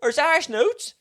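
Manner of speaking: high, loud indignant shout; mock outraged customer. Customer arguing with staff over banknotes, mock outraged. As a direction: protest in hammed-up exasperation.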